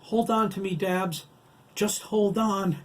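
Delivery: high, loud urgent call; crying out. Acted. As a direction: cry out to hold him fast. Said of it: "Chris cried".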